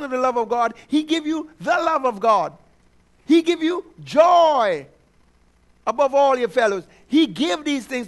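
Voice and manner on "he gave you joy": slow, with "He" punched high; emphatic preaching. Acted. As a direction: hammer each word of "He gave you joy". Preacher building to a climax.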